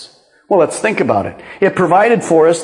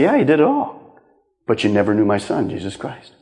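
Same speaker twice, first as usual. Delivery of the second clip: God's solemn reply; 9 semitones lower, 6 dB quieter than usual. Retold or imitated. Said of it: "and God said".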